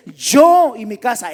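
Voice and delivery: "yo" thrown out loud and long, the rest falling; stress on YO. Retold or imitated. Critic pointing out a grammatical error, loud stress on "yo". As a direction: punch "yo" hard, putting it up front. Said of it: "él se pone por delante".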